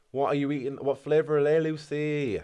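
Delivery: usual speaking voice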